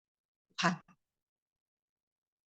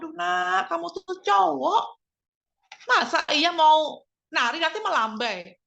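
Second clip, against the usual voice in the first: higher, louder, slowed fretting; dismayed scolding; 8 semitones higher, 4 dB louder, slower than usual. Retold or imitated. Mother frets at her son for wanting to dance, higher and louder. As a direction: fuss at the son in mock alarm. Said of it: "Saya bilang".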